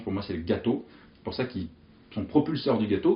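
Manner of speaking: usual speaking voice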